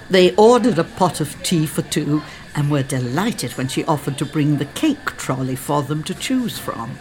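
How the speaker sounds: ordinary delivery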